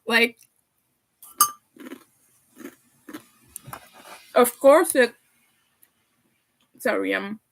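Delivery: talking with a mouth full. Real, not acted. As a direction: talk through a mouthful of noodles. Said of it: "talking with my mouth full".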